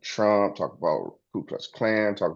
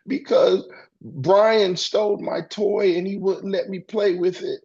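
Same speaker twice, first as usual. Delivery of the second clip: put-on much higher, slow whiny child voice; child complaining; 11 semitones higher than usual. A little child telling an adult why they cry, high whiny voice. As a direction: whine it out like a wronged little kid.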